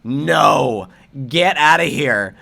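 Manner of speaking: loud, flat brush-off; firm dismissal. Pretend. Players refusing the disgraced guest, loud brush-off. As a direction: throw him out of the room. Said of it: "they all should have been like".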